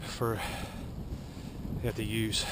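slow, breathless and soft; gasping for air after the steep hill; out of breath. Real, not acted. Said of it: "Sorry, I'm out of breath".

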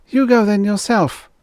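high-pitched retort; defiant cry. A narrator's reading. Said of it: "cried Jisa".

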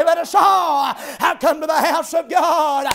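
usual speaking voice

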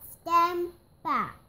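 loud, high command; shouted order. A narrator's reading. Police chief warning people away from bear, shouting.

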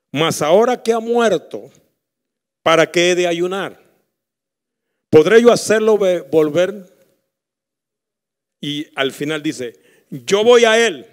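loud, rising preacher voice; fiery sermon delivery; preaching at full force. Acted. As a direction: drive the story home from the pulpit. Preaches David's words.

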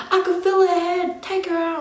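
high, urgent mock scream; retold screaming. Mother retells begging during labour, mock screaming. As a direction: re-enact a panicked cry, pitched high without full volume. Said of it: "I was full screaming my head off".

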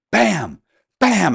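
loud, high punched bursts; acted-out thumps. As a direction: shout each blow as it lands. Acts out hitting the dashboard.

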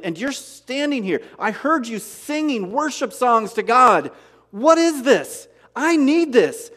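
pleading jailer. Retold or imitated. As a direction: beg like a shaken jailer. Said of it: "He's like".

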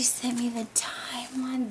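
soft whisper; whispered to herself; whispered aside. Real, not acted. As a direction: breathe it as a secret aside. Quiet aside while unboxing.

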